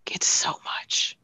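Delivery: unvoiced whisper, slow. Real, not acted. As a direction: breathe it out as a whisper. Aside about injury load.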